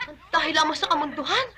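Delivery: usual speaking voice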